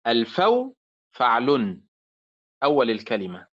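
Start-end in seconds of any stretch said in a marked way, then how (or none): 0.1-1.8 الفو فعل drawn out slowly; slow demo. Shown as a demonstration. Teacher slowly matching الفو to its pattern فعل. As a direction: stretch الفو against فعل. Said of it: "الفوز".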